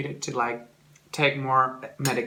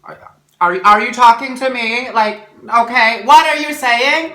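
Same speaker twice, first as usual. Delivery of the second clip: exasperated shouting; 11 semitones higher, 14 dB louder than usual. Retold or imitated. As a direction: demand loudly that the mumbler make sense. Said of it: "and I'm just".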